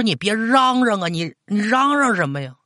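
high-pitched anxious shushing; urgent scolding. A narrator's reading. Grandmother tells grandson to stop shouting, urgent high scolding. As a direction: hurry to stop the noise. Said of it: "奶奶赶紧说".

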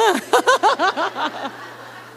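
a burst of high laughter; laughing. Real, not acted. Preacher laughing at her own bold question to the saleswoman. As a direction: let the laugh spill out.

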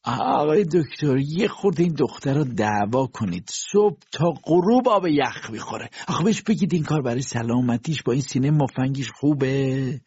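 lightly voiced whining old princess. A narrator's reading. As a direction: nag the doctor between groans. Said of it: "مادرش میان نک و نال گفت".

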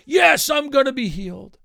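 loud, high mock declaration of faith; forced willpower shout. Preacher mimicking someone willing themselves to be healed, loud mock zeal. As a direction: belt it out as hammed-up self-willed zeal. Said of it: "It wasn't an act of their will".

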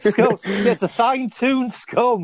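broad Yorkshire fine-tuned scone, slow and high; Yorkshire accent. Shown as a demonstration. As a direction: lean into the Yorkshire. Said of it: "We're talking too much Yorkshire".

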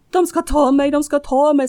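high, loud panicked cry; woman screaming in fear to her husband at night; terrified scream. Retold or imitated. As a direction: scream it out in terror. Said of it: "skriker jag rätt ut".

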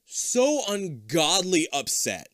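slow, emphatic dismay; exaggerated, drawn-out exasperation; upset. Real, not acted. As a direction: drag it out, really aggrieved. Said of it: "and I'm very upset".

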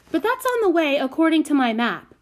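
high, loud, bossy objection; insistent. A narrator's reading. Prudence correcting the carter with her map, insistent. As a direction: argue it high and pushy.

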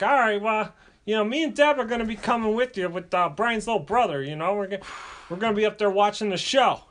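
imitating a friend's dad in a high voice; high-voiced dad. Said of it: "He's like".

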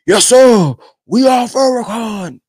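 high, slow proud chant; hammed-up devotee cheer; mock eager follower. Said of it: "we got the shirts on".

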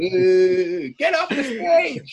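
high, slow, loud heckler shout; loud drunk heckling. Pretend. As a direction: yell it off the stage. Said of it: "I said".

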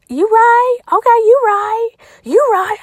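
high, loud put-on voice conceding; high loud put-on you right; mock agreement. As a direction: sassily concede in a cartoonish high voice.

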